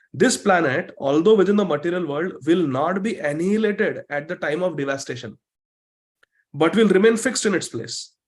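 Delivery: slow, emphatic reading with lifted pitch; measured, declarative teaching recitation; scripture read aloud. Real, not acted. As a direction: read it out to the class phrase by phrase. Reads English commentary to the class.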